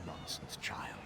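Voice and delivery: soft dismissive whisper. Acted. Priest gently dismissing the assassin's guilt, whispered. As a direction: brush the doubt aside in a hush.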